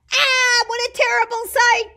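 high, loud horrified yell; yelling in alarm. Acted. Boy sees a terrible sight and yells in alarm. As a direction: yell it with all your might. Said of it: "Timothy yells with all his might".

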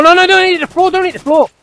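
high, loud laughing panic; real excited shouting; excited yell. Real, not acted. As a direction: shout at the falling enemy. Enemy drops dead in game.

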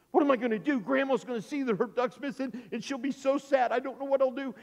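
high-pitched worried fretting; panicked. Acted. Boy fears grandma will miss her duck, panicked. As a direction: fret like a child in trouble. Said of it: "now he panicked".

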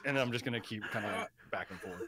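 ordinary talk

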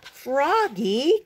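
high, loud two-note call. A narrator's reading. Mother calling Froggy out of the pond, calling out. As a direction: call your child in from the water. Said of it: "called his mother".

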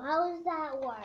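usual speaking voice

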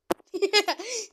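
loud high burst of laughter; gleeful laugh. Real, not acted. Laughing at weak enemy.

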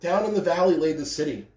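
plain reading voice

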